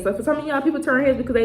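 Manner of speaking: ordinary talk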